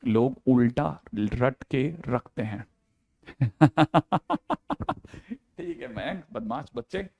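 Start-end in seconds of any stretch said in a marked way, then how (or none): 0.1-2.7 drawn out word by word; slow, deliberate repeat. Shown as a demonstration. Teacher repeats the point to the class, slow and deliberate. 3.4-4.9 long run of laughter; real laugh. Real, not acted. Teacher breaks up after his own line, laughing.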